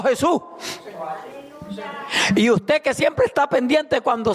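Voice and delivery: high, rising pulpit preaching; impassioned sermon delivery; preaching at full force. Acted. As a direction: drive the warning home to the church.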